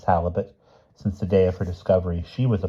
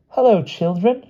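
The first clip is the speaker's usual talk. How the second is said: very high, bright greeting call; crying out; 14 semitones higher, 4 dB louder than usual. A narrator's reading. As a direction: sing out the greeting, high and eager. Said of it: "she cried".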